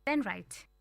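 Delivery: ordinary delivery, nothing marked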